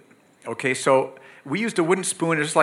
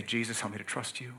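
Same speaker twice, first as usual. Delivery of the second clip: quiet prayer; 3 semitones lower, 10 dB quieter, faster than usual. Retold or imitated. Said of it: "You just say".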